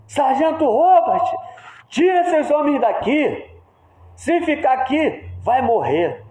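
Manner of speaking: high, forceful officer's command; Capitão Miller. Acted. Captain ordering sergeant to move the men, high urgent command. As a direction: give an urgent order as if under fire. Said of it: "o Capitão Miller fala assim".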